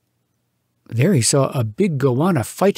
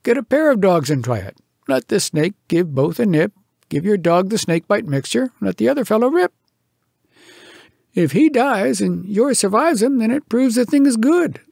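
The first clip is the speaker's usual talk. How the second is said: higher, drily mocking advice; raised, sardonic lecturing voice; wry scientist; 6 semitones higher than usual. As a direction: humour the fool. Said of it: "Said the scientific person".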